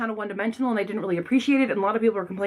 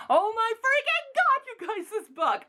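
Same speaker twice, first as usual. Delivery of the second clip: much higher, slow, stretched-out gasp of delight; excited gush; 11 semitones higher than usual. Real, not acted. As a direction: burst out with giddy excitement. Gushing about favourite sequel.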